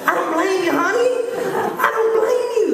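high-pitched, climbing reply; emphatic comic sympathy. Acted. Husband agreeing with his startled wife, comically high. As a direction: voice jumps up high on the repeat.

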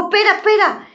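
mother stopping the father with the gun; mother pleading. Acted. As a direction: stop him, urgently. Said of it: "mamá le dijo".

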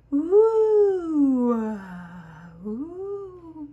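wind howl. Acted. Wind blowing sound.

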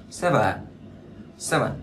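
"seven" falling away after SE; first-syllable stress. Shown as a demonstration. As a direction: stress SE in "seven", let VEN drop. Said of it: "xe là cao hơn, vần là đi xuống".

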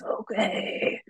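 put-on breathy whispered old man. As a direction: wheeze it out like a frail old man. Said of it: "but in an old man voice".

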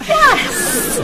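high, eager yes; excited, high-pitched agreement; thrilled yes. Acted. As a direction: agree with sudden delight. Thrilled someone likes the painting.

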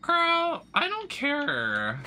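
high-pitched, drawn-out sassy brush-off; sassy dismissal. Pretend. Streamer brushing off a belt she doesn't care about, high sassy drawl. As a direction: wave it off like a diva, slowly.

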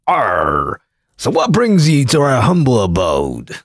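slow growly pirate drawl. Retold or imitated. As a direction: talk it like an old sea captain. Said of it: "is he talking like a pirate?".

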